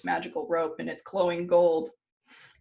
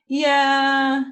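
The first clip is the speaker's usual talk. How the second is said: long, loud, higher drawn-out yeah; mock-apologetic drawl; 7 semitones higher, 8 dB louder than usual. Storyteller as Odin breaking bad news, mock-apologetic drawl. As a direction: stretch it out before bad news.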